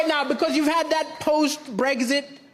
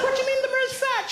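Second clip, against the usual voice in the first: incredulous; 10 semitones higher, faster than usual. Retold or imitated. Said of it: "I said".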